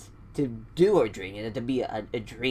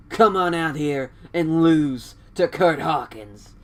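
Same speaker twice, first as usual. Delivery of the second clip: Kurt Hawkins; 4 semitones higher, 5 dB louder than usual. Retold or imitated. Wrestler challenging whoever is in the back, hammed-up declaiming. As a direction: declaim it in grand, separated phrases.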